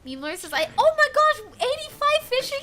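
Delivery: normal talking voice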